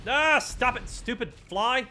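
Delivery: high irritated shout; annoyed yell. Real, not acted. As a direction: snap at the pesky fly. Annoyed at flying enemy.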